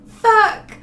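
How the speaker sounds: sudden high loud curse. Real, not acted. Startled swear at back porch fears, loud and high. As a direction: blurt it out in a spike. Fright after own laugh.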